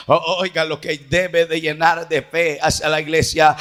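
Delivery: low, settled sermon voice; subdued preaching. Real, not acted. Preacher tells church Christ paid the price, low and restrained. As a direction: come down from the shout into measured, low exhortation.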